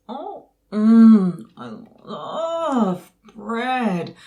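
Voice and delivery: loud moan into drawn-out words; slow, swooning food lust; rapturous savouring. Acted. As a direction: linger greedily over every word. Raptures over beloved bread.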